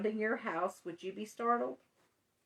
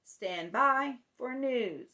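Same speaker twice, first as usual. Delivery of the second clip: slow, raised radio announcer call; Paul Harvey; 2 semitones higher, 4 dB louder, slower than usual. Retold or imitated. Host imitating a famous newsman for fans, announcer delivery. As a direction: imitate a newsman's punchy, drawn-out broadcast opening. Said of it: "he would say".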